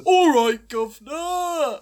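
slow, loud Cockney greeting; Cockney accent. Retold or imitated. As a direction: drawl it out like a cheeky Londoner. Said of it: "a vaguely Cockney accent".

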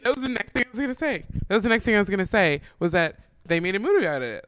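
loud, emphatic vindication; excited agreement. Real, not acted. Co-host seizing on the movie point she was about to make, excited. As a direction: burst in loudly, thrilled to be beaten to it.

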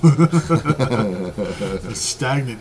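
slow, laughing through the words; chuckling as you say it. Real, not acted. Laughing while joking about mule.

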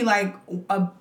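usual speaking voice

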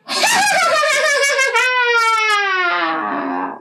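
high, fading witchy cackle; crazy neighbor cackling as she drives away; witch cackle. Retold or imitated.

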